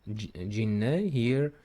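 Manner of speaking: normal talking voice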